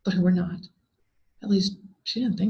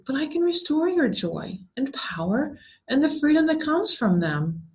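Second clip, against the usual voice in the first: higher, louder spectral voice, persuasive; ghost voice; 7 semitones higher, 3 dB louder than usual. A narrator's reading. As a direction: tempt her like a ghost offering freedom. The ghost's long speech.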